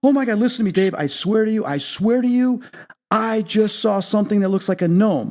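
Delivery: high-pitched, insistent disbelief; excited retelling. Retold or imitated. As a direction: act out excitedly swearing it is true. Retells excited call to Dave.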